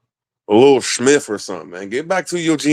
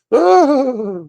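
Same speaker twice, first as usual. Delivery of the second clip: high, loud, drawn-out falsetto "ah"; hammed-up falsetto ah; mock high sigh; 4 dB louder than usual. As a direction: squeal it like a prim lady.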